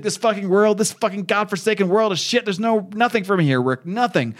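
high, heated cursing outburst; acted despairing rant. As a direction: curse the world in an acted meltdown.

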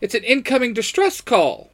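high-pitched urgent announcement; alarmed robot assistant. Acted. Alarmed Alpha announces distress call.

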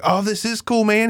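high, thrilled stoner buddy voice; mock-excited high voice. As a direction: gush like an overjoyed friend. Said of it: "And Gata's like".

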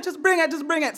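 voicing mom. Mom dismissing her son's worries, high mock mom.

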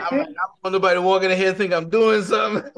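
loud, high-pitched worried protest; mock panic. Man alone in the store with a crying woman, mock panic. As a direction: raise voice and pitch in comic alarm. Retelling his alarm beside crying customer.

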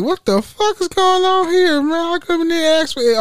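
high-pitched, exasperated protest; retold indignant disbelief. Venting about a coworker who blamed him, indignant. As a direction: voice jumps up in baffled complaint. Retells his baffled reaction to coworker.

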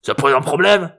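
high, cranky grandpa retort; challenging old man. A narrator's reading.

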